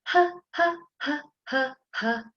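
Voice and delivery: panting sung high. Shown as a demonstration. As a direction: pant along with the song. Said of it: "our jolly phonics song".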